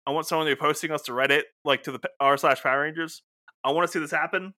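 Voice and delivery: ordinary talk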